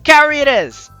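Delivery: normal talking voice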